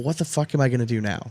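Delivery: ordinary delivery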